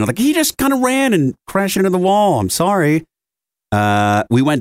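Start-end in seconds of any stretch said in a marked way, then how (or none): 0.2-3.0 sheepish explanation. Retold or imitated.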